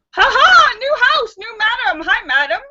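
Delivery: high, loud squawking parrot. Acted. The brothel parrot greeting the new owner, high squawk. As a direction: screech it like a cheeky parrot. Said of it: "looks around and says".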